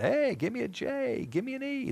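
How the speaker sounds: high-pitched cheerleader chant; excited mock cheer. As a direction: chant like an excited crowd spelling a name. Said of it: "They were all excited".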